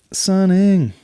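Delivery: high, loud, stretched cry of the word; deafening outburst. Real, not acted. Comedian mocking the trend, deafeningly loud high cry. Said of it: "Sorry, you probably went deaf there".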